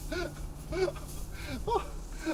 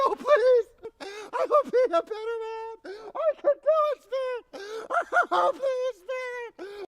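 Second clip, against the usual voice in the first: high, broken pleading; tearful begging; 6 semitones higher, 3 dB quieter than usual. Acted. Scrooge begs the spirit at his grave, tearful and high. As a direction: beg the spirit through tears.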